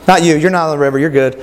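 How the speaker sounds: ordinary talk, nothing marked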